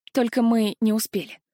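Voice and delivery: ordinary delivery, nothing marked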